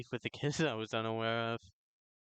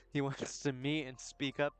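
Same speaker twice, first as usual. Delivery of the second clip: ordinary talk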